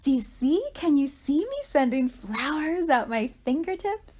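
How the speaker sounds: put-on high, loud little-kid voice; high-pitched little girl. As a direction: do an excited small child's high voice. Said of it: "talking with this high-pitched voice".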